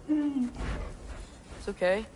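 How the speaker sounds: slow, calming words to an animal; soothing. Real, not acted. Atreus calming Ben the animal, slow soothing voice. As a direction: settle him down gently.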